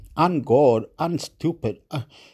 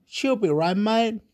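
broad Aussie greeting, pitched higher; Australian accent; 5 semitones higher than usual. Shown as a demonstration. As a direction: lay on the Australian twang, a little higher. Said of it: "in Australia, we say like this".

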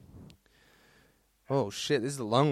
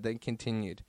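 normal talking voice

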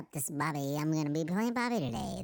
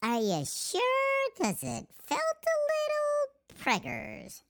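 high feminine voice; slower than usual. Acted. High voice character continues.

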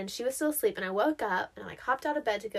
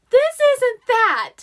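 much louder, higher, slow insistence; loud protest; 13 semitones higher, 15 dB louder, slower than usual. Retold or imitated. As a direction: insist over the mower noise to mom. Retold protest to mom.

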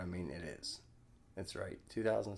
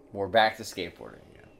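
normal talking voice

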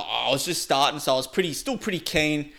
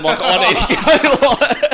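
slow, higher and louder, breaking into laughter; laughing; 10 semitones higher, 10 dB louder, slower than usual. Real, not acted. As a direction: giggle through the plea. Laughing while retelling urgent need.

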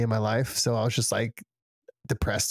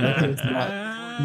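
ordinary talk, nothing marked

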